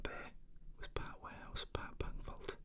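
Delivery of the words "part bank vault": ordinary delivery, nothing marked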